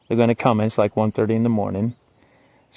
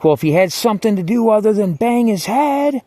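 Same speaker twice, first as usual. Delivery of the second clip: high, louder retort yelled through the door; yelling at the guard; 11 semitones higher, 4 dB louder than usual. Retold or imitated. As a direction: yell it back at her, indignant. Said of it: "I yell at her".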